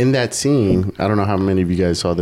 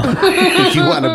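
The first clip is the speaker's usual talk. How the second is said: laughing; 4 dB louder, slower than usual. Pretend. Laughing high mock voice.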